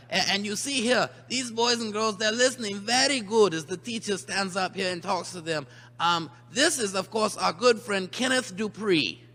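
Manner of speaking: ordinary talk, nothing marked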